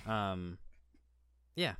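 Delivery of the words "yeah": usual speaking voice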